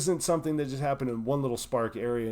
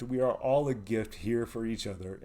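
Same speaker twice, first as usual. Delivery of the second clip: ordinary talk, nothing marked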